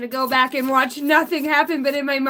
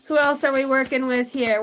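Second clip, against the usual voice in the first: ordinary talk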